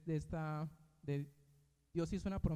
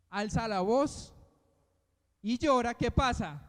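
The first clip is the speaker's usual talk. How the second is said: louder, higher, slowing for emphasis; raised preaching voice; 5 semitones higher, 7 dB louder, slower than usual. Real, not acted. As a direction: raise your voice to rouse the congregation. Preacher dramatizes Ismael raising his voice. (alzando la voz al predicar, con énfasis)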